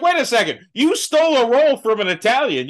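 high, incredulous mock protest; mock indignation. Retorting to the actor in mock disbelief. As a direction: act out the outrage. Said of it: "I'm like".

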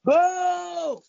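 playful booing at a friend; mock boo. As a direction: boo them teasingly.

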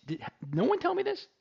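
nobody told me? act stunned; indignant surprise. Real, not acted. Host mock-complaining to his crew, high surprised.

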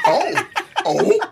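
surprised. Real, not acted. Reacting to shade.

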